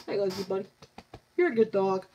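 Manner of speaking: quiet fond praise for the dog; tender. Real, not acted. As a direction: coo gently to your pet.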